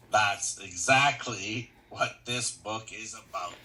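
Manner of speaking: slow, deliberate reading; slowly. Shown as a demonstration. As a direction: drag each word out so it sinks in. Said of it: "Read that again slowly".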